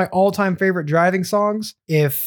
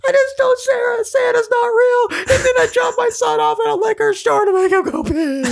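much higher, crying, shouting hammed-up panic; mock freaking out. Mom panicking to her son in the car, hammed-up crying shout. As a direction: wail it like a mom falling apart. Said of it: "He's just freaking out".

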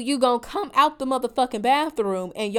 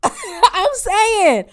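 laughing; 9 semitones higher, 9 dB louder than usual. Real, not acted. Laughs mid-rant.

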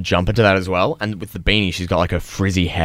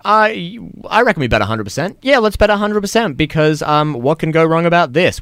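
overconfident housemate; 8 semitones higher than usual. Retold or imitated. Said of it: "they're going".

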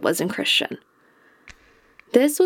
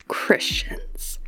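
whispered word; 6 dB quieter than usual. Real, not acted. Hushed naming of persecutors.

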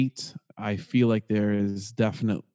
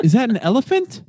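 surprised; 11 semitones higher, 3 dB louder than usual. Real, not acted. High surprised question.

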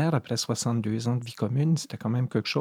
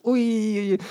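startled yelp; 10 semitones higher, 4 dB louder than usual. Retold or imitated. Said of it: "je dis".

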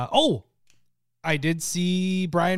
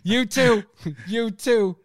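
high, loud flustered "You too"; Brian Regan impression; 7 semitones higher, 6 dB louder, slower than usual. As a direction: parrot the comedian's awkward reply. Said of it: "his cadence".

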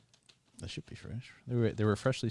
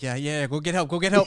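mock panicked yell; 6 semitones higher, 11 dB louder than usual. Imitating a fleeing passerby, loud.

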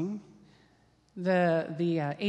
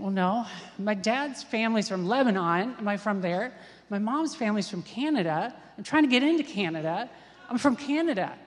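retold mounting panic; 4 dB louder than usual. Thinking through where the teller is from, mock panic building. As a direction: re-enact a racing mind, climbing to a frantic last answer. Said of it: "I was in such a panic".

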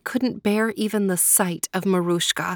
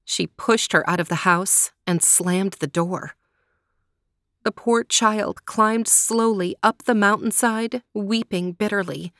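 usual speaking voice

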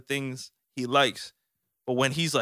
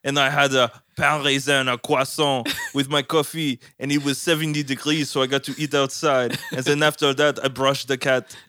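louder, fast, chirpy French dad; 3 semitones higher, 5 dB louder than usual. Retold or imitated. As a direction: brag cheerfully like a French dad. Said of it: "So he's just like".

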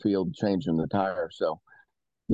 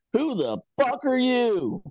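high-pitched, drawn-out angry yell; stretched-out, outraged holler; irate old man; 14 semitones higher, slower than usual. Retold or imitated. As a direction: imitate an irate old man confronting strangers. Acting out angry truck driver.

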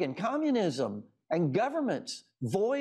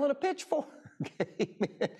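laughing; 8 semitones higher than usual. Real, not acted. Laughing at the cartoon devil.

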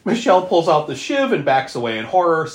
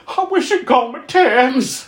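higher, slow whiny hint of Tex; imitating Tex sulking; 10 semitones higher, 4 dB louder, slower than usual. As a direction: drag it out like a sulky grown man. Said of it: "He's like".

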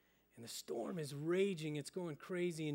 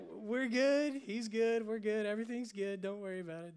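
high, squeaky backing down; nervous backpedal; 7 semitones higher, 8 dB louder than usual. Retold or imitated. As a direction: squeak out a scared excuse to the gang. Bully backs down before gang.

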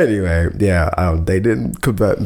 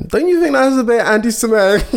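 high polite challenge; 13 semitones higher, faster than usual. Retold or imitated. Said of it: "I was like".

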